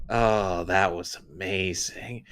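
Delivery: soft and slow, dreamy; mock blissful mom, hushed; breathy satisfied sigh. Mom after sex, imitated.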